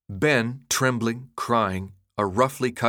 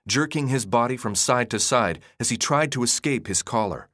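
ordinary delivery, nothing marked

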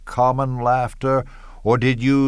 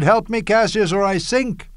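high-pitched, mildly raised cry for help; Caesar crying out; 8 semitones higher than usual. Retold or imitated. Cassius mimics Caesar sinking in the Tiber, crying out. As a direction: call out high, mimicking a drowning man. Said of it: "Caesar cried".

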